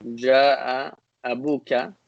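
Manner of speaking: "Ja'a abuka" said slowly, word by word; slow classroom model of "Ja'a abuka"; slow demo. Shown as a demonstration. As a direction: spell out "Ja'a abuka" at half speed so students catch it. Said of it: "if I said".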